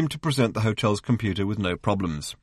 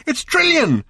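high, loud excited shout; 5 dB louder, slower than usual. A narrator's reading. Arthur shouting the discovery to Ford, loud and high. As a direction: yell the name out. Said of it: "shouted Arthur".

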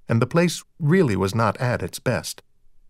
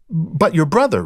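higher, insistent disbelief; baffled protest; 6 semitones higher, 4 dB louder than usual. A narrator's reading. Rita repeats herself to Dexter about the brother, baffled. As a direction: protest in mild bewilderment.

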